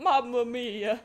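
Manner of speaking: loud, drawn-out hammed-up Italian; playful stage-Italian exclamation, slow and loud; mock Italian accent. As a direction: belt out a jokey Italian accent. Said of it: "Sam has an Italian accent".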